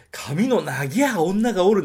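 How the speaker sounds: mock the film's fake Nagoya dialect; unconvincing film Nagoya dialect. Shown as a demonstration. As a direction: read it like a badly coached actor faking Nagoya dialect, ending on plain がおる. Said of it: "なんて絶対言わない".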